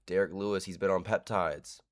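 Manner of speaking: ordinary talk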